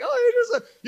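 very high gushing coo; cooing baby talk. Retold or imitated. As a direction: coo over someone waking up. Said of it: "leaning over your bed".

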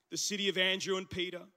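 ordinary talk, nothing marked

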